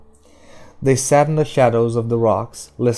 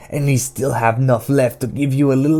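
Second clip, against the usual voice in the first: loud, raised taunting character voice; Detta, mocking; 3 semitones higher, 5 dB louder than usual. A narrator's reading. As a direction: jeer at them in a heavy dialect.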